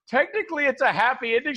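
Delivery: high-pitched hedging, imitated; mock defensive. Weaselly defence to upset professor.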